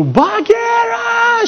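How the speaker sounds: very high drawn-out roar; stretched, squealing cartoon bellow; Baloo. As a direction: howl the name out long and high. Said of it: "Când urlă Bălu".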